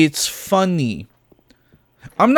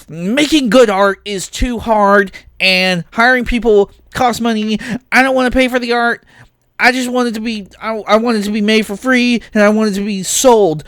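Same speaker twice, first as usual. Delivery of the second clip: high-pitched whiny parody, picking up force; mocking studio boss; 6 semitones higher than usual. Ranter imitating game studios defending AI, mockingly. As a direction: whine it as a greedy, lazy studio. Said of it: "Every game studio, every art".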